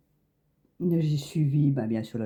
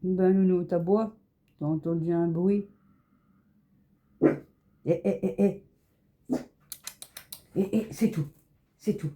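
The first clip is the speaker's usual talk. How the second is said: higher, sing-song pet voice; talking to a dog; 4 semitones higher than usual. Real, not acted. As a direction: coax the dog, voice lifted. Soothing a barking dog.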